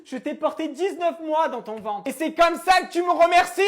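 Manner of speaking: high, loud theatrical reproach; dramatic shout. Acted. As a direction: wail it like a tragic mother. Repeat shouted with music.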